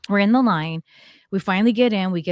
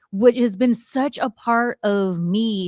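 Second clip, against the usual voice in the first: ordinary delivery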